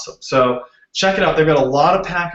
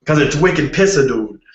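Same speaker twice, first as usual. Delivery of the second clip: thick Boston accent on wicked pissa. Shown as a demonstration. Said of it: "make fun of my Boston accent".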